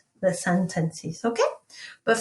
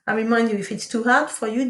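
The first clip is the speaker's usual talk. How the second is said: ordinary delivery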